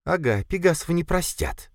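high-voiced, bitter sister; mocking, high-pitched retort; hissed retort. A narrator's reading. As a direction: throw it back with venom. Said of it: "прошипела Катя".

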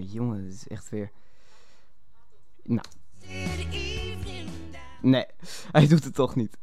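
ordinary talk

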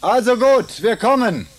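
loud high call; calling back. Acted. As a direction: shout it across to him.

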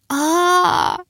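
high, drawn-out wail; pained scream. A narrator's reading. Girl bitten on the arm, pained scream. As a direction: cry out high in pain. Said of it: "завопила я".

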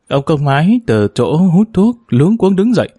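ordinary delivery, nothing marked

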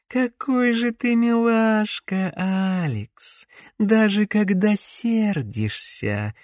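put-on high, slow, syrupy young witch; sugary voice. A narrator's reading. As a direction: coo it sweetly, like honey. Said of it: "елейный голосок".

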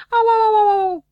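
put-on high, loud complaining little boy; whiny Dunno. Dunno whining to doctor Pilulă that it hurts, high and loud. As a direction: whine at the doctor who lied.

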